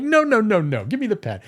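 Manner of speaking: hammed-up alarm; mock panic. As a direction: scramble to fix it. Frantic fixing.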